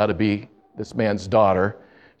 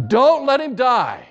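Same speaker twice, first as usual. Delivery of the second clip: high, slow, pleading wail; desperate plea; 14 semitones higher, 4 dB louder, slower than usual. Retold or imitated. Daughter begging the nurse on the phone, high desperate plea. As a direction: beg as if he might die any second.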